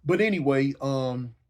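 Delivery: ordinary talk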